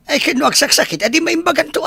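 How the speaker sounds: father protesting over the son's illness; agitated. Acted. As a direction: let the voice jump up, fretting. Father upset about sick son.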